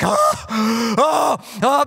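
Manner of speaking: breathy put-on panting oh's; imitating being out of breath; mock winded gasps. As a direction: gasp like you climbed stairs out of shape. Winded on the stairs.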